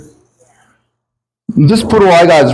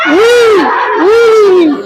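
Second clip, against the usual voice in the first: high shouted woo; porno moan; 3 dB louder than usual.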